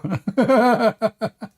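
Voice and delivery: high-pitched, tailing-off giggles; high helpless laughter. Real, not acted. Host at the opponent's exit, helpless laughter. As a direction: laugh until the breath runs out.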